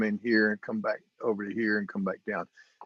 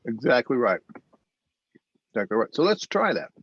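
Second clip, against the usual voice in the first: eating pretzels while talking; mouth full. Real, not acted. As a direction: talk through a mouthful of pretzels. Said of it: "Pardon me, I'm eating pretzels".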